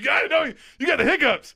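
high, insistent yelling; loud accusation. Real, not acted. Host catching co-host hiccupping, loud accusing yell.

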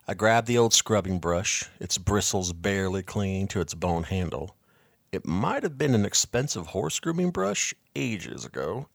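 plain reading voice

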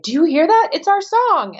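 high-pitched, loud, delighted outburst; excited retold exclamation. Wife to husband in loud restaurant, excited at their song. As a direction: pipe up high and thrilled.

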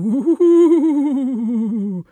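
loud eerie laugh; ghostly laugh. Shown as a demonstration. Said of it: "Ghostly voices".

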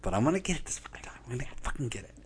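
hushed, fast mock whisper; a villain whispering to himself; whispered vow. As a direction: whisper it like a secret plan. Night King's secret vow.

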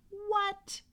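high mock gasp; surprised. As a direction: sound amazed at the fact. Mock surprise at the moon fact.

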